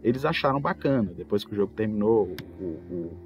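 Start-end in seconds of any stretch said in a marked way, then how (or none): none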